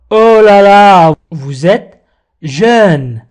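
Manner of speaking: usual speaking voice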